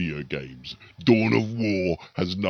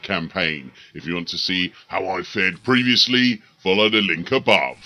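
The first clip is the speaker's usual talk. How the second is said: lightly grand announcer voice, a bit louder; epic narrator intro; 4 dB louder than usual. Acted. YouTuber introducing his battle report, mildly epic announcer. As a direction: open the video like a trailer narrator.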